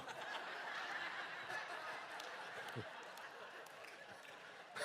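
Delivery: quiet, unvoiced laugh; breathy laugh. Real, not acted. Pastor laughing after the haircut joke, breathy laugh. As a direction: chuckle at the punchline.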